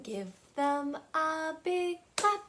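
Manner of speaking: usual speaking voice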